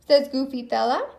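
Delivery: plain reading voice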